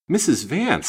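high-pitched call of alarm; startled, voice lifted high. A narrator's reading. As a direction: call out in sudden concern. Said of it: "said he in alarm".

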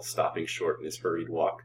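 plain reading voice

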